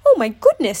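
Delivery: startled Pooh. A narrator's reading. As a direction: cry it out in alarm, loudly. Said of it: "snarled the startled Pooh".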